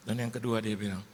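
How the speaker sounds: ordinary talk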